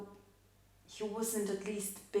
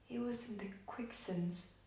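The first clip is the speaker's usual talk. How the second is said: soft, subdued dramatic monologue; hushed confession; 10 dB quieter than usual. Acted. Widow confessing how her husband was sinking, hushed and subdued. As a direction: let it sink almost to nothing.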